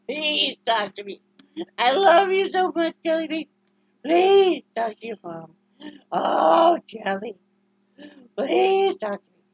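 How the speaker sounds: high, loud, slow begging. Real, not acted. Mom begging her sulking dog to talk to her, high drawn-out pleading.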